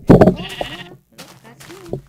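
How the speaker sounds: loud, high mock confirmation; cheery parents, hammed up; parents confirming.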